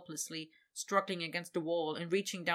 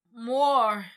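louder, higher insistent plea; 5 semitones higher, 11 dB louder than usual. Acted. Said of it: "insistent and reflexive".

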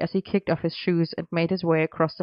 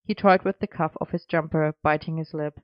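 ordinary delivery, nothing marked

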